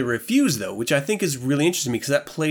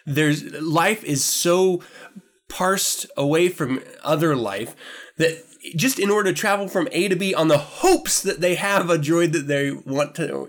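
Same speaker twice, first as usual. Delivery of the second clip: ordinary talk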